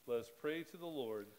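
ordinary talk, nothing marked

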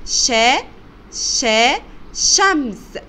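loud, separate شا twice, then the whole word شمس; sounding out. Shown as a demonstration. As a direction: stress the opening شا of شمس for learners. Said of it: "الشين في أول الكلمة زي كلمة".

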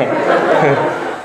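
breathy laugh falling away; airy laugh trailing down. Real, not acted. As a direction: laugh at your own punchline. Laughs after punchline.

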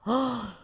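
short audible intake of breath; awed gasp. Real, not acted. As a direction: gasp in delighted surprise. Reacts to darkened game scene. (overrasket gisp)

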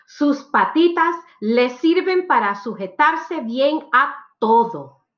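ordinary delivery

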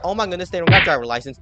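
ordinary delivery

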